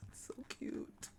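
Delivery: soft, slow whisper; whispered gush. Real, not acted. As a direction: breathe it out in an adoring whisper. Whispering over the cute pet.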